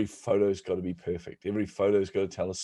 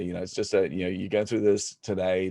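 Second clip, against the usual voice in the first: usual speaking voice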